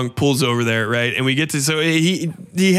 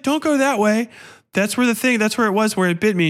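panicked warning; 6 semitones higher than usual. Retold or imitated. Injured man warning Tessa.

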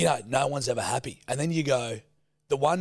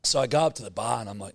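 normal talking voice

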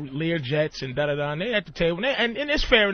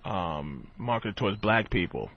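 much lower, deadpan pitch-man voice; rich businessman voice; 10 semitones lower, 7 dB quieter than usual. Retold or imitated. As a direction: play the stiff rich guy pitching his idea, low. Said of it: "one guy goes".

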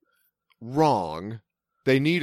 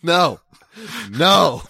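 loud laughing no; 6 semitones higher, 7 dB louder than usual. Real, not acted. Laughing refusal of tackle.

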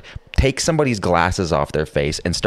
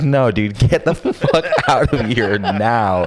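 laughing; 5 semitones higher, slower than usual. Retold or imitated.

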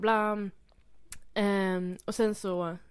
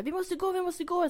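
put-on hurried teen girl; 10 semitones higher than usual. Kompisen stressar på.

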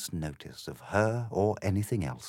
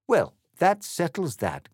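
smug Corbyn voice; 10 semitones higher, 5 dB louder than usual. A narrator's reading. Corbyn to himself.

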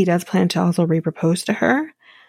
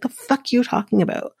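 retold angry yell; 6 semitones higher than usual. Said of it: "they start yelling at each other".

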